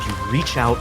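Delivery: usual speaking voice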